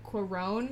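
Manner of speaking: Cuarón with the stress on the last syllable, rón; corrected stress. Shown as a demonstration. Co-host correcting the host's Cuarón, stress on rón. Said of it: "emphasis on the wrong syllable".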